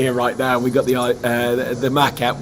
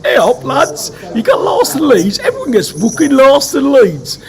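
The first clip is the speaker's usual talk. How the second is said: higher, laughing Scunny impression, Yorkshire greeting; imitating Scunny; 9 semitones higher, 4 dB louder than usual. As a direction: do Scunny teasing them through a laugh, pitched up. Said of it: "Scunny rings up and goes".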